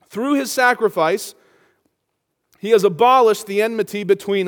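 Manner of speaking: preaching at full force. Acted. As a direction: preach it out to the whole congregation.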